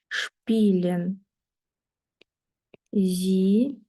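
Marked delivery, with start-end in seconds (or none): none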